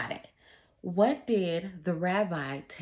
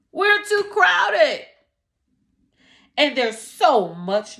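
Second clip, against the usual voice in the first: loud, higher, slow pleading; desperate poor man; 4 semitones higher, 11 dB louder, slower than usual. Acted. Poor man pleads with the rabbi about his crowded hut, loud desperate begging. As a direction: beg for help, voice straining.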